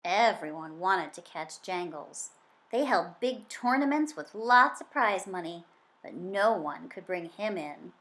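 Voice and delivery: usual speaking voice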